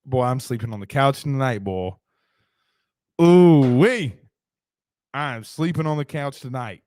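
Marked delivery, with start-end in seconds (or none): 3.2-4.2 loud whooping ooh-wee; a loud whistle-like holler. Real, not acted. After wife yelling joke.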